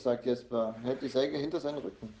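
plain reading voice

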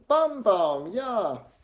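put-on high, loud toddler cheer; excited little child's shout; calling out. A narrator's reading. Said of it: "ruft sie".

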